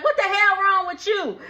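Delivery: high mock outburst; indignant yell. [name] to Brooke banging on shower door, indignant. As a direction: act out being barged in on.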